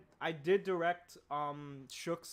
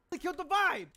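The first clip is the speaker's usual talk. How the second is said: much higher, louder indignant cry; high outraged character voice; 13 semitones higher, 8 dB louder than usual. Shown as a demonstration. Character reacts to friends dying, high and loud. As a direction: squeal it at a dead friend. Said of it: "This guy just seen his friends die".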